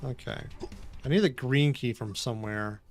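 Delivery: ordinary talk, nothing marked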